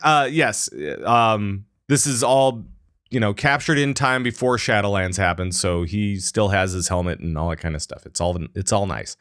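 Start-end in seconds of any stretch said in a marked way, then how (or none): none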